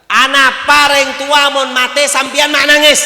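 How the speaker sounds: high, loud challenge to the crowd; shouted question. Real, not acted. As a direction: call it out to the whole hall. (teriakan bertanya)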